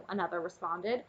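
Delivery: usual speaking voice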